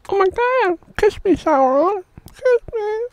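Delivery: high falsetto begging; hammed-up lovesick lady; mock swooning woman.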